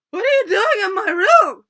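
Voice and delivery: high, furious mock scream; very loud, high-pitched outburst. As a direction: shriek it like an outraged drunk woman. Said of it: "screaming at this poor little girl".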